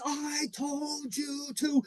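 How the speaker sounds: slow, high scolding line; angry scold. Retold or imitated. Coach scolds the player who missed his spy, slow high scolding. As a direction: drag out every word in anger. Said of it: "top of my lungs".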